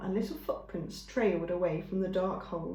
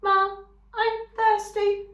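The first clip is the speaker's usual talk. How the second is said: weak sick gosling; 13 semitones higher, 7 dB louder, slower than usual. Acted. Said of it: "he whispered".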